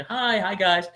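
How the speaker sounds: high bright naive hello; cheerful greeting. Retold or imitated. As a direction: wave hello to strangers cheerfully. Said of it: "I was just so cheerful".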